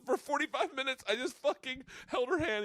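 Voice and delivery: usual speaking voice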